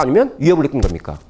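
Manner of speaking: ordinary talk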